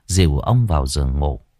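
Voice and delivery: plain reading voice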